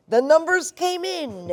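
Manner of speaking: high, loud and drawn out; elated, slow, swooping call; excited exclamation. Real, not acted. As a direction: announce it as a big triumphant moment. Recalls thrilling opening night results.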